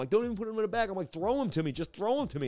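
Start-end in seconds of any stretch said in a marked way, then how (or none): 0.1-0.9 high frantic mock yell; urgent and high, not full volume; retold screaming. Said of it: "I'm, like, screaming in the restaurant". 1.1-2.5 high, urgent mock yell; mild imitation of screaming; retold screaming. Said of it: "I'm, like, screaming in the restaurant".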